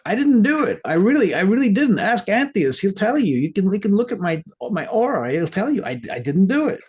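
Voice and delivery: high-pitched, frantic protest of innocence; acted pleading denial. Jackie, getting all the blame, denies it to Randy, pleading. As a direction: plead loudly in a hammed-up high voice.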